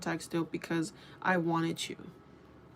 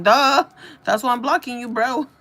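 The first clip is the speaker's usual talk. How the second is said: high, shouted "duh" retort; loud mocking comeback; 5 semitones higher, 12 dB louder than usual. Woman retelling her comeback to blocked friend, loud mocking. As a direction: yell it as obvious, laughing after.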